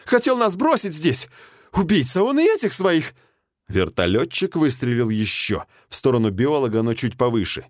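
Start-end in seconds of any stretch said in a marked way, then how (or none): 0.1-3.1 high frantic yell; panicked shout. Acted. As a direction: cry it out high and upset. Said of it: "крикнул биолог".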